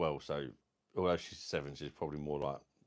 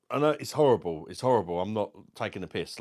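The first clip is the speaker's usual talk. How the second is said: ordinary talk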